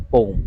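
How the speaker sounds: "पोम" in one syllable, POHM; no "yum" in "पोम", just one long o; claimed correct pronunciation. Shown as a demonstration. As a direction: say "पोम" as pohm, rhyming with home. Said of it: "सही उच्चारण पोम ही है".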